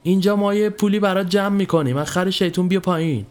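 acted holler, pitched well up; calling out upward. A narrator's reading. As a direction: call up to someone overhead. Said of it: "سرم را بالا کردم و داد زدم".

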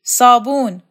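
sharp, loud shout for soap. Acted. Shokat yelling for soap over the steaming basin, loud shout. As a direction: bark the single word out across the room. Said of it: "شوکت داد کشید".

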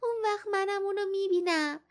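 high little voice, begging; pleading child. A narrator's reading. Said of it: "با التماس".

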